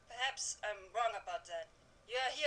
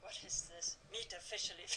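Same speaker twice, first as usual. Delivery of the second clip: 1 amused laugh; 3 semitones lower, 8 dB quieter than usual. Acted.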